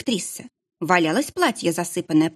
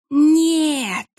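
put-on high strained wail of an old witch; hoarse cry; 9 semitones higher, 4 dB louder than usual. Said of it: "хрипела директриса". (хриплый крик)

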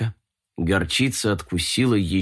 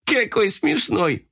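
amused; 13 semitones higher, slower than usual. Acted. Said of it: "улыбнулся Коржик".

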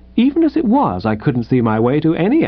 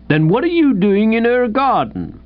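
interrogating policeman; 9 semitones higher than usual. Acted.

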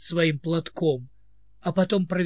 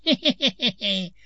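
put-on old man's giggle; 3 semitones higher than usual. A narrator's reading. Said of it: "Марлаграм ничуть не смутился".

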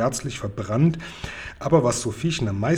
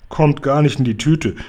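louder giant's firm refusal; giant calls out; 3 semitones higher, 7 dB louder than usual. Acted. Giant refuses Sophiechen's idea, calling out louder. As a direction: call it out firmly, no way. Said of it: "rief der Guri".

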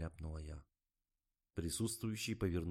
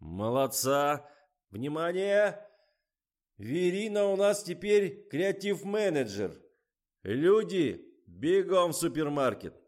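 director's call; 10 semitones higher, 11 dB louder, slower than usual. A narrator's reading. Director rallying his crew.

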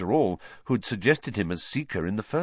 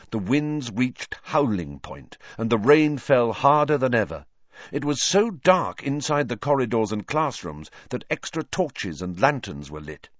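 usual speaking voice

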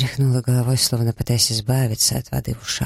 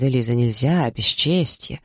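plain reading voice

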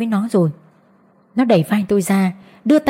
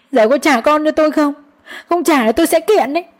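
higher, tearful threatening plea; crying; 7 semitones higher than usual. Acted. Mother tearfully threatens to sue the in-laws, crying. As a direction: threaten through tears. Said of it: "vừa khóc vừa nói".

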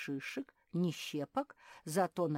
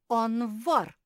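loud, higher-pitched eager cry; excited exclamation; 7 semitones higher, 13 dB louder than usual. A narrator's reading. Мари calls out her accusation of a thief, excitedly. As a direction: call it out with relish. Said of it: "азартно воскликнула Мари".

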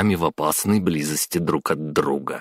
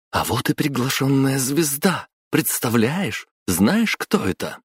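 high, eager squeal of excitement; thrilled fangirl; excited; 8 semitones higher than usual. A narrator's reading. Excited girl at star arrival.

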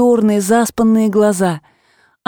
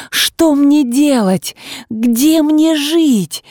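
pleading; 6 semitones higher, much slower than usual. Acted. Wife begs husband for orders.

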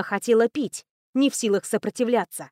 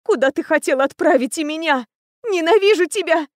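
high, louder outburst of rage; grief turned to fury; 6 semitones higher, 4 dB louder than usual. A narrator's reading. Captive turns on the prince who took her, furious. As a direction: build to a furious, raised cry. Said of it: "Горе мгновенно превратилось в ярость".

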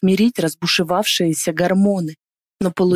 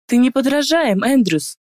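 mocking sarcasm; 6 semitones higher than usual. A narrator's reading. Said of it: "Усмехаюсь".

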